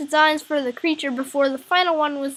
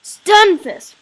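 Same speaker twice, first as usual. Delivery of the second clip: excited; 6 semitones higher, 9 dB louder than usual. Real, not acted. Announcing next Pokemon.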